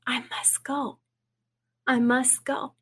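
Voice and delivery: soft, half-whispered vow; quietly retold declaration; hushed resolve. Retelling the resolve she heard.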